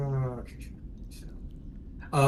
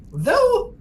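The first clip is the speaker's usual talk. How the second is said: very high, louder birdlike squawks; squawky Kenku; 8 semitones higher, 4 dB louder, much slower than usual. Acted. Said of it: "the next one says".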